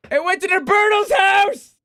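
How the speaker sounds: high, loud furious cry of disbelief; angry shout. Real, not acted. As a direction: yell it out, outraged. Food delivered to wrong house.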